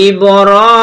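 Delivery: "ইব্রাহিমা" slow, with "রা" and "হি" stretched long; drawn-out vowels. Shown as a demonstration. Teacher showing where to draw it out, slow stretched "ইব্রাহিমা". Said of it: "এখানে র টেনে পড়বো".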